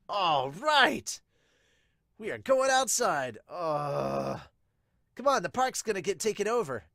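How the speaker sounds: put-on a boy itching to get to the park; eager kid shouting. Kid urging friends outside.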